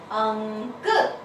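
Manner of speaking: very slowly stretched अंक, each sound held; drawn-out answer. Shown as a demonstration. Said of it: "तो क्या बना?".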